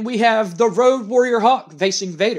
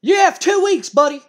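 much higher and louder yell of "You have two weeks"; furious shout; 12 semitones higher, 6 dB louder than usual. Retold or imitated. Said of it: "And Jim Cornette goes".

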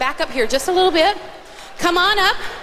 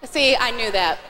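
normal talking voice

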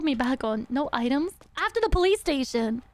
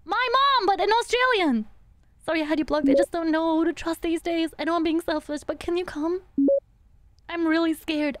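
normal talking voice

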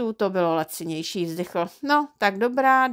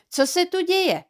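much higher, startled woman's question; alarmed wife; 9 semitones higher than usual. A narrator's reading. Neighbour's wife running in on two men shoving, alarmed. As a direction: break in on the quarrel as a worried wife.

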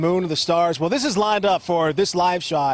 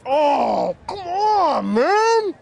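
excited shout; 8 semitones higher, much slower than usual. Real, not acted. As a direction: yell out in food bliss. Reacting to deep fried treat.

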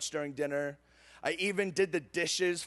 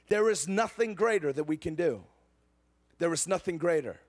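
normal talking voice

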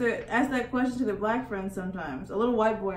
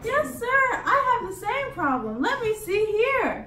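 higher-pitched, eager shop worker; chirpy helpful worker; 11 semitones higher, 3 dB louder, slower than usual. Acted. Worker answers odd request, high helpful character voice. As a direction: play an obliging clerk, stretching the last words.

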